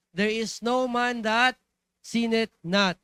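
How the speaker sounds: slow, loud, weighty scripture reading; solemn verse reading. Acted. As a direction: drag out each word of the verse. Verse read slowly, loudly.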